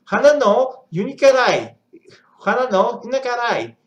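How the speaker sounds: loud faux Japanese; mock Japanese. Shown as a demonstration. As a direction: hit it high like a proud learner. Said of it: "Έχω την προφορά".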